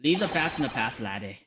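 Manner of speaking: put-on lilting Scottish accent; Scottish character. Dismissing old details to a visitor, Scottish accent. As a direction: play an old-fashioned Scot waving off the past.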